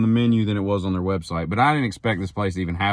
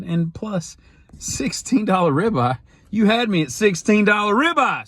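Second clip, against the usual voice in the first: delighted sold; 10 semitones higher than usual. Real, not acted. Decides on the cheap steakhouse.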